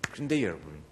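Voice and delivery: ordinary talk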